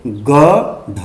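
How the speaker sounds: गढ़ split, with a hard aspirated ḍh; wrong stop sound. Shown as a demonstration. Teacher showing a slip, गढ़ with stopped ḍh. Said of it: "गलती से".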